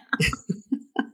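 airy giggling burst; high breathy laugh. Real, not acted.

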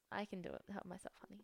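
unvoiced whisper. Real, not acted. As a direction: breathe it with no voice. Whispered aside.

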